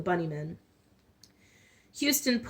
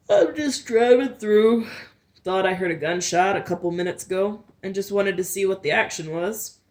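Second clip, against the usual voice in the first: sheriff's voice; 5 dB louder than usual. A narrator's reading. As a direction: play the sheriff calling from his car. Sheriff's dialogue in narrated story.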